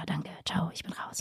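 whispered sign-off; whispered goodbye to the viewers. Acted. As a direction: breathe it out, no voice. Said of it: "Immer wieder flüstern".

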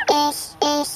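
tiny high peeping voice; peeping baby chick. Acted. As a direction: squeak it out eagerly. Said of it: "piepste es aus der Ecke".

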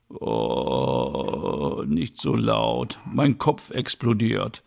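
slow, groaning, low hungover voice. Acted. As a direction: wince through a pounding headache. Ole wakes up hungover.

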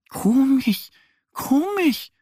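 hohe Entenstimme, verwundert; grübelnde Ente. Acted. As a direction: sag es wie eine ratlose kleine Ente. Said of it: "meint Annie". (verwunderte Ente)

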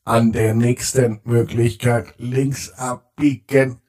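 slow robotic sat-nav announcement; navigation voice. Acted. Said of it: "Die Stimme sagt". (Navi-Stimme)

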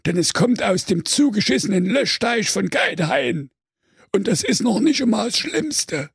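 Hugo Hydrant. Acted. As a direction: warn like a spooky talking hydrant. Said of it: "da flüsterte es auf einmal".

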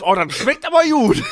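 high, loud laughing mock delight; naive delight. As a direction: play the fooled eager taster. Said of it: "Du denkst zuerst".

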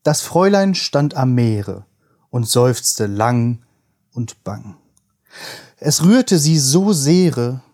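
slow, measured poem reading; poem recitation. Acted. Reads Heine poem aloud.

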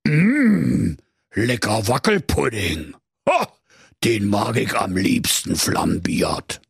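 a greedy dragon musing over its meal. Acted.

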